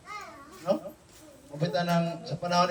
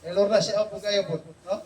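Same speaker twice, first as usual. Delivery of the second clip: ordinary delivery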